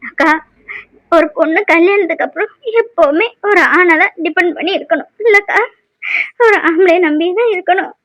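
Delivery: halting speech through tears; tearful lament. Acted. Single mother laments to elder sister about living alone, tearful. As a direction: pour out the hurt between sniffs. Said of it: "இங்கே அழுதுட்டு இருக்குது".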